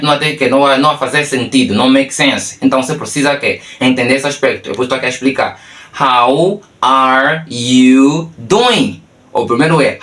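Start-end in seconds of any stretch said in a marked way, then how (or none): 6.0-9.0 extremely slow, each word separate; slow demonstration. Shown as a demonstration. Teacher explains the second phrase to learners, slow demonstration. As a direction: draw out every word with gaps.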